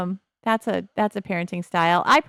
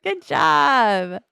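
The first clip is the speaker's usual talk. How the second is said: higher, louder friend voice; pitched-up praise from a buddy; voicing a friend; 5 semitones higher, 4 dB louder than usual. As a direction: say it like a cheery sim friend congratulating. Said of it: "They're like".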